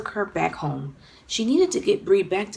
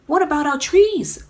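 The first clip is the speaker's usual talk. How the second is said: put-on worried child; 8 semitones higher, 7 dB louder than usual. A narrator's reading.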